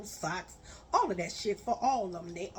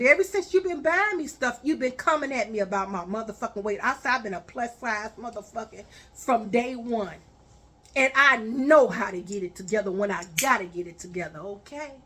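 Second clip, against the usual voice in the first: louder and faster, scolding; angry rant; 7 dB louder than usual. Retold or imitated. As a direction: tear into him, raising your voice.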